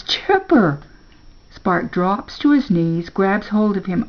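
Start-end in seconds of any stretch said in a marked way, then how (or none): 0.0-0.8 higher-pitched cry of the name; alarmed calling. A narrator's reading. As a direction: call out urgently to a friend in danger. Calling the sinking dog.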